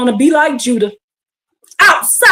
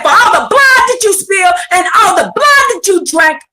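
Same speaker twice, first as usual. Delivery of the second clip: preaching; 7 semitones higher than usual. Acted. Preaching the prophecy.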